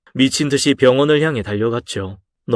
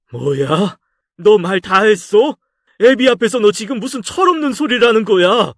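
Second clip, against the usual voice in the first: outraged father; 8 semitones higher than usual. Acted.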